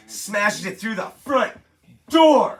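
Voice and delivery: slow build to a loud, high DOOR; dramatic build. Real, not acted. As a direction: pause after front, then blast out DOOR. Building to the movie's climax.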